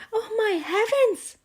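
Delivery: high, loud shocked mother; alarmed cry. Acted. Mother shocked at striped daughter, loud high cry. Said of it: "she cried".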